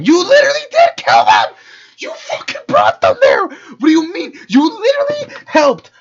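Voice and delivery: high, loud furious yelling at the screen; furious shout. Real, not acted. Reacting to killer's phone confession with furious screaming. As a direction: scream your outrage at him.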